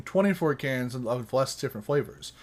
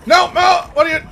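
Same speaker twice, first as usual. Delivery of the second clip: high, loud frantic yelling; panicked shouting; 6 dB louder than usual. Real, not acted. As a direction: scream it in panic. Panicked in the game.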